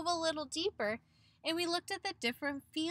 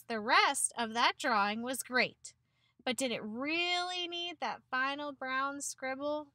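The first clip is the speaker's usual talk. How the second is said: usual speaking voice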